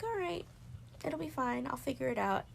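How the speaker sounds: high mock breezy self-talk; breezy reassurance. Reassuring herself while boxing it up, high breezy. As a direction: brush it off brightly. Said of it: "and I'm like".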